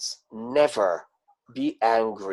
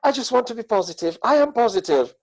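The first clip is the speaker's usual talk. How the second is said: fast, much higher, chirpy insistence; mock positive; 10 semitones higher, faster than usual. Mocking someone who claims they hate drama, fast chirpy. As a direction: rattle it off like a forced optimist.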